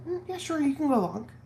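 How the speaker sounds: imitated husband, high-pitched offhand assent; high, casual quoted reply; offhand agreement. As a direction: lightly mimic a noncommittal yes, pitched high. Husband's offhand reply to her request.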